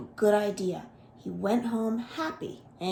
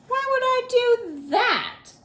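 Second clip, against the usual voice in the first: put-on high, puzzled young voice; a small creature pushing back, much higher; Guji Guji asking; 5 dB louder than usual. A narrator's reading. Guji Guji questions the crocodiles, high and puzzled. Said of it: "Guji Guji asked".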